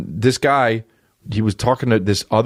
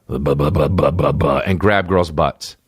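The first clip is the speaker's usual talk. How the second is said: lower, mindless babble; dopey guy babbling; 5 semitones lower than usual. Retold or imitated. Comedian mocking a creep's dumb babble, low. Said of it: "a guy who would be like".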